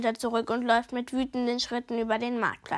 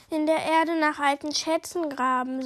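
ordinary delivery

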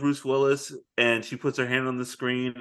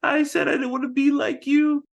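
high mock sobbing girl; imitating Liv Tyler's goodbye; mock tearful daughter; 10 semitones higher than usual. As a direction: plead it like a weepy daughter. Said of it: "She's like".

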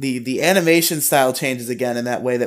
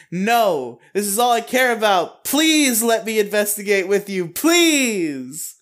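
higher, begging plea to join the investigation; pleading Naomi; 8 semitones higher than usual. Retold or imitated. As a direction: beg him to let you in, voice pitched up. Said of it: "that leads her to be like".

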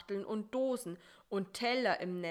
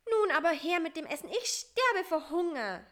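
high, loud, very fast; urgent demand; 9 semitones higher, 7 dB louder than usual. A narrator's reading. Starving fly demands the food at last, high and urgent. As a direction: demand it in a high, impatient rush.

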